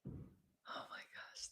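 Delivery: a slow, breathy whisper; whispered aside. Real, not acted. To the viewers, reading the workbook, a whispered aside. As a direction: whisper it like a private joke.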